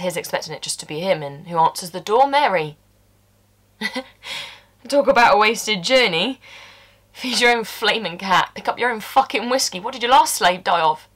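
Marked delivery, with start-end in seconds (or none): 4.9-11.1 angry rant. Acted. Fed up with feeding the cat, angry. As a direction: spit out the grievances. Ranting about Mary's demands.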